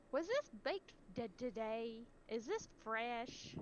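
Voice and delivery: put-on high, slow Southern old lady drawl; Southern old lady accent. Imitating a Southern old lady customer asking if the bread is fresh, in her accent. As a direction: play a fussy Southern grandma asking. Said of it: "general Southern old lady accent".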